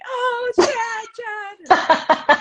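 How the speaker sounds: high, loud dreamy sighing over Chad; imitating a smitten fan; swooning. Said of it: "[name] started going".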